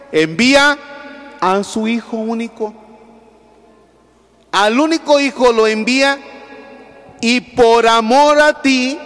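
loud, high preaching build; fervent preaching. Acted. Priest preaching God's love to the faithful, fervent and loud. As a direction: preach it out to the congregation. Preaching peak over music.

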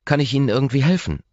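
Ross's voice. Acted. Ross offers help.

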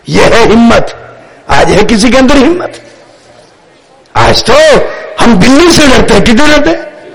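usual speaking voice

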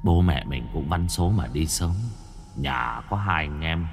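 low, gentle dying elder brother; softly entrust the family; ghost brother's last wishes. A narrator's reading. Dead brother entrusts family to younger brother, low and gentle.

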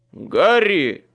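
loud high shout of a name. Acted. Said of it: "крикнул Майкл".

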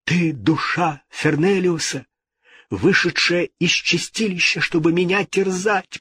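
high-pitched anxious address; fearful king. A narrator's reading. King questioning a tormenting ghost, fearful. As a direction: speak high, in dread of the spirit.

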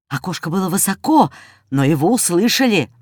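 usual speaking voice

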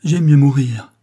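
a desperate cry, loud; cried out. Retold or imitated. André retelling his wife's last words, loud cry. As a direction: throw the line out like a cry before leaping. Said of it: "Elle a crié".

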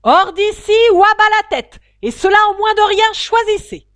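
very loud, high raging queen; queen ordering the duchess off, screaming; furious shout. Acted. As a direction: scream the ultimatum. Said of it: "cria la reine".

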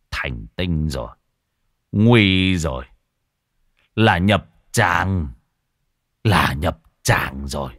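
low, slow dread-filled monk; ominous monk. Acted. Monk realising the corpse is possessed, low and slow. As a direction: let each word drop slowly, sensing doom.

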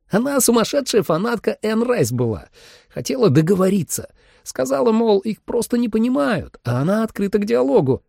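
high, animated storyteller; excited high-voiced chatterbox; chatty hunter. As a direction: play a talkative hunter, pitched well up. Narrator voices chatty hunter Gus.

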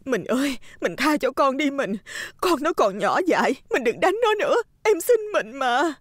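high, pleading wife's voice; tearful begging. A narrator's reading. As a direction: implore your husband desperately. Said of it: "quỳ xuống ôm chân chồng van xin".

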